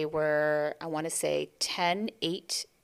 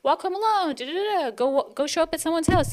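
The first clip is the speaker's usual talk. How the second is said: much higher, singsong prodding; cheerful urging mom; 10 semitones higher, 3 dB louder than usual. Retold or imitated. Mother urging kids to roam free, much higher. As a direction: chirp it breezily at the kids.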